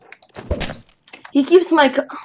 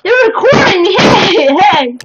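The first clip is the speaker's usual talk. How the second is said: excited shout; 6 semitones higher, 4 dB louder than usual. Acted. Mock panic at being recorded.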